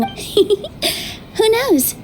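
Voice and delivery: a teasing little laugh on who knows; laughing lightly. Acted. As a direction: laugh it off, playful. Teasing about the future.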